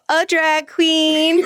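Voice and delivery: high, very drawn-out mock kid answer; child's proud announcement. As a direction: stretch it out high, like a kid onstage. Retells own proud childhood answer.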